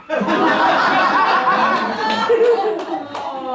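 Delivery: big high laugh; loud laugh. Real, not acted. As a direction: burst out laughing. Laughs at own punchline.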